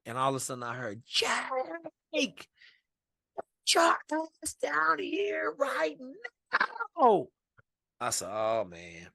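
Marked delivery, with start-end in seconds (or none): 1.1-7.3 high, drawn-out drill yell; screaming instructor. Retold or imitated. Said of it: "like, screaming at them". 8.3-9.1 dismay. Retold or imitated. Dismayed reaction to being summoned.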